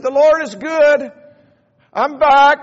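lightly voiced joyful old man; a hint of a glad old neighbour; old man Rubin. Mister Rubin voiced in story.

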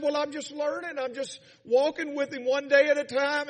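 high, loud, emotional retelling; happy-tearful. Retold or imitated. New believer crying and smiling, happy-tearful. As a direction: imitate someone overcome with joy. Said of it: "we're crying and we're smiling".

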